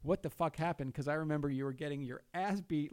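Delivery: ordinary talk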